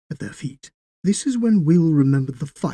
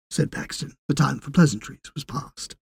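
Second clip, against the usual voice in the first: ordinary delivery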